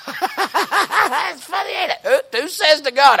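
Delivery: high-pitched, laughing through the words. Real, not acted. Preacher amused that anyone would boast to God, laughing. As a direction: crack up while talking. Laughing at his own mock quote.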